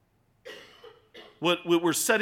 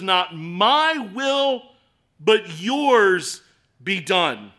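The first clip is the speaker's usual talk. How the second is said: slow solemn quote; 5 semitones higher, 10 dB louder, much slower than usual. Acted. Quoting Jesus in the garden.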